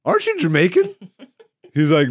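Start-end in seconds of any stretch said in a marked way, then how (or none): 0.0-0.9 high-pitched, puzzled challenge; Triple H. Retold or imitated. Podcaster voicing wrestler questioning teammate, high mock query. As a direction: call out a wrestler on his dropped accent. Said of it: "Triple H is like".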